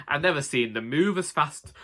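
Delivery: plain reading voice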